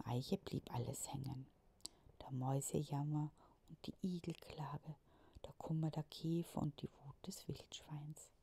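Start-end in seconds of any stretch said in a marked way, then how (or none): none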